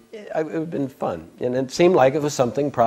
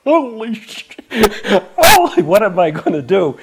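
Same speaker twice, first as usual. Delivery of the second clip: shocked exclamation; 11 semitones higher, 10 dB louder than usual. Real, not acted. Shock at being granted sannyas.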